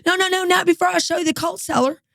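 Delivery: don't let him leave, pleading brightly; insistent hostess. Retold or imitated. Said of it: "She goes".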